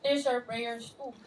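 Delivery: hushed, slow, sincere; soft slow repeat. Shown as a demonstration. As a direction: lay the words down softly. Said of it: "hindi siya prayer".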